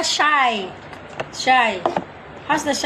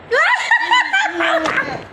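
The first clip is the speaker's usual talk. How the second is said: burst of laughter. Real, not acted.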